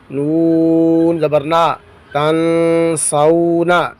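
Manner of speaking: slow, drawn-out spelling in a reciting tone; measured recitation drill. Shown as a demonstration. Teacher spells Quranic words aloud for students, measured recitation. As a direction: chant each letter and vowel as if before a class.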